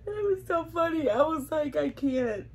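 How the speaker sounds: high, helpless laughing wail; teary, breaking into big laughs between phrases; crying with laughter. Real, not acted. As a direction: laugh until the words squeeze out. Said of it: "I'm crying 'cause it's just so funny".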